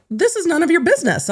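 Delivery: high, snippy imitation of the lawyer; mock outraged lawyer, pitched high; mock indignant man. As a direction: play him as a huffy, high-voiced know-it-all.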